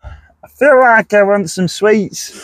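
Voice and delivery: drawn-out put-on northern whine; northern accent. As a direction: stretch it out in a playful northern accent. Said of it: "don't know why I went northern".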